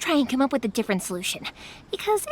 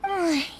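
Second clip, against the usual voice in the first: quiet falling sigh; soft sigh; 3 semitones higher, 4 dB quieter than usual. Acted. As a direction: breathe out a small sad sigh. Sighs over friend's plight.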